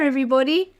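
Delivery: usual speaking voice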